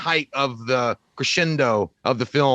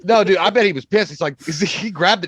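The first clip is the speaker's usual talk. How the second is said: usual speaking voice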